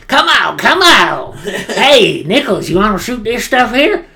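high, loud hammed-up Southern soldier; bad Southern accent. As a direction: overact a fake Southern accent. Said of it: "supposed to be a southern dude".

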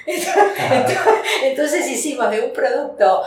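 laughing through "entonces", pitch up high. Real, not acted. Guest laughing at her own menu wordplay, laughing. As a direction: giggle out the words, amused at the pun.

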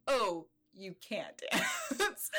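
drawn-out retold "oh", breaking into a laugh; mock dismay. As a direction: imitate a let-down choreographer, slowly. Retold choreographer reacting to bad dancer.

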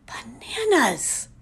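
bananas whispered slowly. Real, not acted. Vlogger whispers how much it drives her mad. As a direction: breathe out bananas, half-crazed. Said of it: "It makes me".

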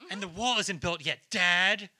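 put-on loud, whiny teen; teen angst. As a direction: whine it at Dad like a teen. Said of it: "The teenage angst".

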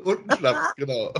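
high laughing voice; real laughter breaking through. Real, not acted. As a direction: giggle the words out. Both laughing at the joke.

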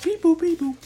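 high beep-boop like a ringing phone; phone ringing noise. Pretend. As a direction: imitate the phone dialing, high and bouncy. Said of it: "I gotta ring you up".

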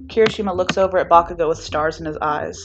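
plain reading voice

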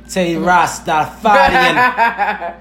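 hint of a Jamaican accent, drawn out slowly; mock Jamaican accent. Host clowning before the bonus song, mock Jamaican accent. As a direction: lightly put on a Jamaican accent. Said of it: "smoke some Jamaican boy".

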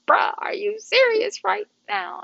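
high, drawn-out mock dismay; dismayed disbelief. Daughter hearing dad is across the street, dismayed disbelief. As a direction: stretch it out in high-pitched disbelief. Panicked reply to dad nearby.

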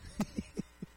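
brief unvoiced chuckle; short laugh. Real, not acted. Reader laughing after trying an accent on a quote, short chuckle. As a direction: laugh at your own attempt.

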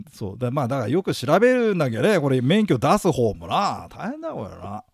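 ordinary talk

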